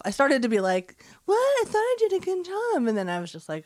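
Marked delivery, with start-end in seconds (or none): 1.3-2.9 high-pitched indignant protest; affronted, comically high; mock hurt surprise. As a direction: retell it as wounded disbelief, pitched up. Acts own hurt reaction to comment.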